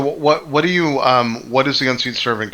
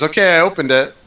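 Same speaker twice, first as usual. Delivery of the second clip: triumphant; 4 semitones higher, 3 dB louder than usual. Acted. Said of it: "And he says".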